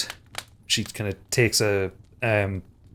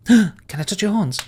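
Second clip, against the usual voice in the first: gasping, much higher, eager; excited child; 11 semitones higher, 4 dB louder than usual. Acted. Caitlin thrilled at the stranger's offer, high and eager. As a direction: gasp and ask with childlike delight.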